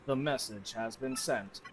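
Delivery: low, slow machine voice; a calm computer speaking low; computer TEC. Acted. TEC voice again.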